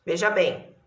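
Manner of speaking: ordinary talk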